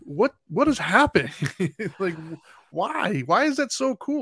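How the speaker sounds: rising, drawn-out what has happened; baffled disbelief. Real, not acted. Friend on a podcast, baffled by silent Uber rides, disbelieving. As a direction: ask it like you truly can't believe it.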